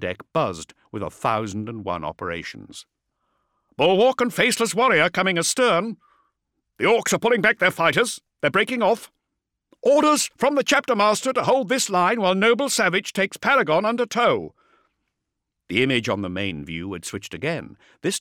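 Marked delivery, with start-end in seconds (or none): 3.8-14.5 brisk, higher female officer; vox officer voice. A narrator's reading. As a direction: report it like a busy comms officer.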